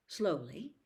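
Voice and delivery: plain reading voice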